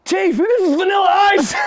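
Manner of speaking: high, loud excited call; hammed-up excited cop; mock cop shout. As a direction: yell to the chief in disbelief. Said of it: "And they go".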